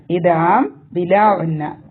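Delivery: ordinary talk, nothing marked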